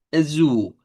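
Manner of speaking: "azul" ending in a U-like L; Portuguese azul. Shown as a demonstration. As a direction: model "azul" with the final L as U. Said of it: "hay dos U ahí".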